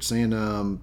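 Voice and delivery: ordinary talk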